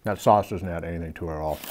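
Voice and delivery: talking with food in mouth; chewing. Real, not acted. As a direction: mouth partly full. Seen 2: Bit burger at -3, jaw still working, food in mouth during words.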